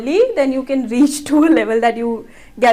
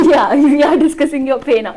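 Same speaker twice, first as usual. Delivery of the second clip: higher, louder, through a laugh; 4 semitones higher, 5 dB louder than usual. Real, not acted. Host to guest about discussing pay, laughing. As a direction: laugh while confirming the question. Amused reply to pay question.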